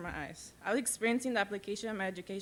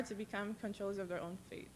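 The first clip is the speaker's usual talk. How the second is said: teary; 2 semitones lower, 13 dB quieter than usual. Real, not acted. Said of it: "Sorry, I'm getting really teary".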